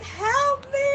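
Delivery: high, far-off cry for help; mock-frantic high cry for help; damsel in distress. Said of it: "this damsel in distress".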